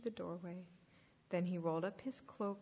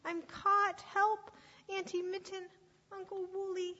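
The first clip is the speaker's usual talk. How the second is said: high pleading, bursting into shouts; trapped little sheep calling high; desperate cries; 9 semitones higher, 3 dB louder than usual. Acted. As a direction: call out frantically for rescue. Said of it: "Blister cried again".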